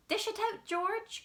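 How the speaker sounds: high, loud, urgent; eager cry. Acted. Mister Cranky urging George on. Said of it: "cried Mister Cranky".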